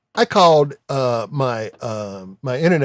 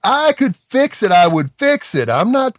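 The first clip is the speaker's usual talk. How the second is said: exasperated retold rant; 5 semitones higher than usual. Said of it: "I'm like".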